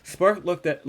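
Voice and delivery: normal talking voice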